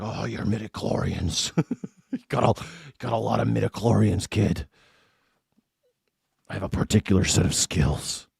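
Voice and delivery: lightly voiced quiet Qui-Gon; a hint of Neeson's menace, softly; Liam Neeson as Qui-Gon. Streamer retelling Qui-Gon testing Anakin, quiet Neeson voice. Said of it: "He's like".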